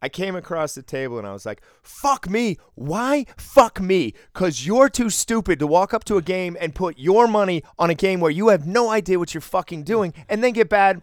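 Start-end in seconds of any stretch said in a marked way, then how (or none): 1.8-11.0 furious retold yell, much higher and louder; snapping angry yell. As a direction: unload on the rude gambler at full shout. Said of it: "So I snapped a little bit".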